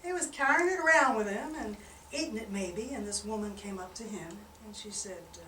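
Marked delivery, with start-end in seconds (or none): none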